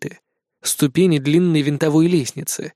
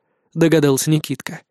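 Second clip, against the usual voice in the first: ordinary delivery, nothing marked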